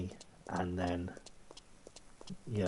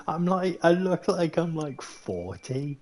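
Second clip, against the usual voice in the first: much higher and louder, incredulous; indignant outburst; 10 semitones higher, 8 dB louder than usual. Real, not acted. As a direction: protest with a laugh in it. Indignant about being called lad.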